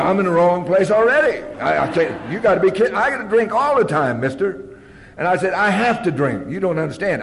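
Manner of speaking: high, loud, insistent objection; exasperated protest. Retold or imitated. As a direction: protest as if utterly misunderstood. Protests to the AA caller.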